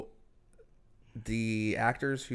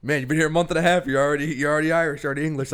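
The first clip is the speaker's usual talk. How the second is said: mock exasperated rant; 3 semitones higher, 6 dB louder, faster than usual. Mock outburst at the movie.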